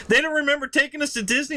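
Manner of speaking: high, quick disbelief; incredulous. Retold or imitated. Brother hearing the parents forgot, incredulous. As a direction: brother can't believe it.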